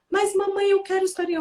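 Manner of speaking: put-on high, sustained child's plea; pleading child. Son begging mom for bedtime story, high mock pleading. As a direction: imitate a child begging for a treat.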